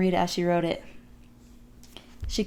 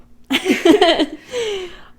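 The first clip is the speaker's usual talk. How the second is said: laughing burst; 10 semitones higher, 7 dB louder than usual. Real, not acted.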